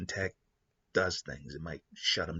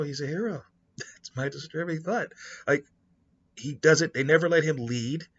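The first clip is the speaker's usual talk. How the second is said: ordinary talk, nothing marked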